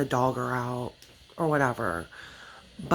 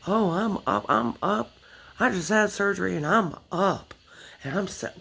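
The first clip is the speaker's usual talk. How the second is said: bragging guy; 4 semitones higher than usual. Retold or imitated. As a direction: mimic a smug bragging patient. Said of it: "he was, like, bragging".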